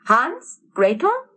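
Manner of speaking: put-on a mother calling her children home. A narrator's reading. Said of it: "called out a familiar voice".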